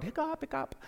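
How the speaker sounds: high squeaky hiccups in a row; small repeated mock hiccups; imitated hiccups. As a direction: hiccup softly along, high-pitched. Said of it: "hiccuping all day".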